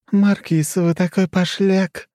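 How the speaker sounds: high, slow coy purr; playful purr. Acted. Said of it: "игриво промурлыкала Хрущева".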